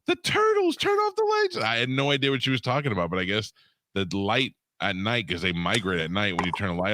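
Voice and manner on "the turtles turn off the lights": much higher shrill mock woman voice; frantic woman. Imitating the turtle lady at the door, high-pitched. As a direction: squeal it like a panicked neighbor. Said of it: "And she's like".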